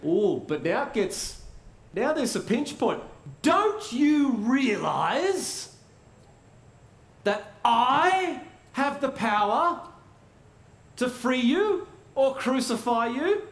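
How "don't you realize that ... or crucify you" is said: high, slow, haughty governor; imperious Pilate. Acted. Pilate threatening Jesus with crucifixion, imperious and slow. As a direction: lord your power over him, drawn out and high.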